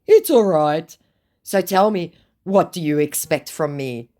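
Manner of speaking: a hint of a pushy scammer; scammer's put-on voice. Reads scammer's reply in a voice.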